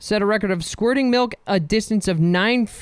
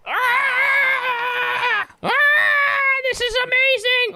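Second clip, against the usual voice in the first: high, ecstatic screaming; thrilled skydiver; much slower than usual. Pretend. Skydiver mid-jump, screaming with delight. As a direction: yell with joy while falling.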